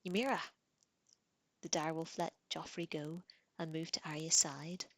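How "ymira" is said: sharp call. A narrator's reading. Girl calling her wolf off, sharp command. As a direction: crack the name out like a whip. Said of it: "voice cracked like a whip".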